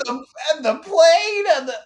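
very high mocking comic impression; Seinfeld. Co-host teasing the host's bad impression, squeaky mock Seinfeld. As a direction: squeal it as a caricature of a stand-up. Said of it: "closer and closer to Seinfeld".

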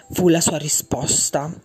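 ordinary delivery, nothing marked

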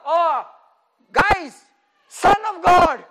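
loud excited call to the gang; mock devil calling out. Preacher voices Satan, loudly calling his demons. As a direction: shout the news to the others.

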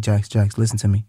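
put-on low, gentle voice for a child; calm the upset son down; reassuring dad. Father soothing his eight-year-old son Jackson, reassuring. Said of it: "I just be like".

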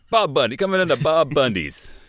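hammed-up car-lot pitchman; used car salesman. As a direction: sell it like a cheesy car dealer. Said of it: "A used car salesman".

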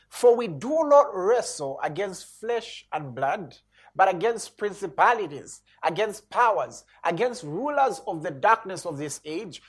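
slow, emphatic scripture reading; preaching scripture. Acted. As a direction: read it out like a preacher. Preacher reads scripture aloud.

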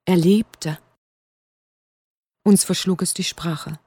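ordinary delivery

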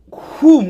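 loud, explosive "whoom"; imitated punch whoosh. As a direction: punch out a big whooshing impact noise.

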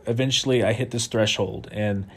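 ordinary talk

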